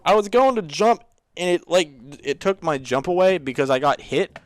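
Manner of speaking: loud, high gamer frustration; exasperated outburst. Real, not acted. Player complains about losing the jump, exasperated.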